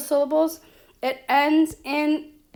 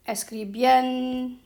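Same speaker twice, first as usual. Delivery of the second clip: "escribiendo" with BIEN stressed and drawn out; "escribiendo" broken after a long, strong BIEN. Shown as a demonstration. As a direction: lean on the BIEN in "escribiendo", then pause. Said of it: "Our stress was there".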